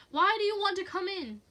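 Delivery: high, loud question; desperate cry; frightened demand. Acted. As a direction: plead loudly with the intruder. Terrified woman cries to intruder.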